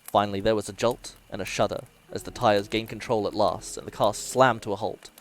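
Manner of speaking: ordinary delivery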